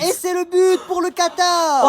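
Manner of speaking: match commentary. Acted. Commentator calling the match to viewers, loud and high. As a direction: call the goal for the whole stadium.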